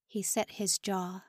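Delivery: usual speaking voice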